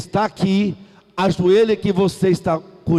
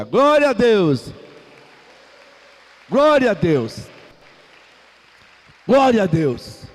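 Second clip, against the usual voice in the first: praise shout; 3 semitones higher than usual. Real, not acted. Preacher calls out praise.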